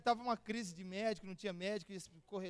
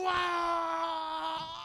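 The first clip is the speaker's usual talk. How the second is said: a loud, tearful cry of wonder; a crying shout of pure awe; awestruck cry; 7 semitones higher, 6 dB louder than usual. Retold or imitated. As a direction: cry out in overwhelmed amazement. Overwhelmed on the glowing mountain.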